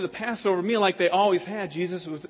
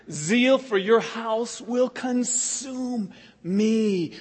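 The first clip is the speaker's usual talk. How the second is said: slow scripture reading; 2 semitones higher, much slower than usual. Shown as a demonstration.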